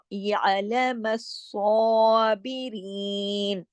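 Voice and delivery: व यालम स्वाबिरीन with throat ع and heavy स्वा; very slow Quranic reading; slow recitation. Shown as a demonstration. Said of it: "खींच के पूरा स्वाबिरीन".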